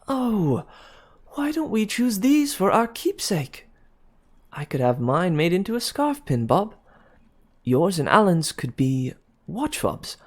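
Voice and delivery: high, gushing excitement; bubbling over with a sudden idea; young woman thrilled by her clever plan; excited idea. A narrator's reading. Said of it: "whirled about at a sudden idea".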